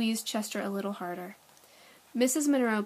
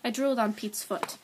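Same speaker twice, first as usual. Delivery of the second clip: ordinary delivery, nothing marked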